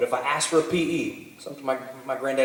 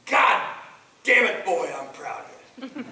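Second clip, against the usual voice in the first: scolding granddaddy; 5 semitones higher than usual. Retold or imitated. Granddaddy to grandson before the watching crowd, mock scolding turning proud. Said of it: "he's just gonna fucking scold me".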